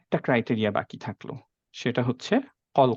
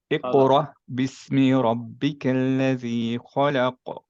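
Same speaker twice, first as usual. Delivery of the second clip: slow, drawn-out Quranic recitation; much slower than usual. Acted. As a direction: chant it slowly like tilawah.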